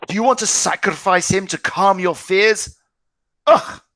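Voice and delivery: high, loud contemptuous reproach; furious accusation. Acted. Son accusing his father of betrayal, furious. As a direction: accuse him with disgust.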